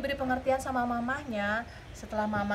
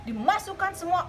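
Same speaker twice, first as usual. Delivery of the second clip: ordinary delivery, nothing marked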